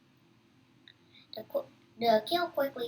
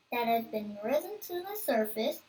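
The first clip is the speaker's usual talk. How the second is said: usual speaking voice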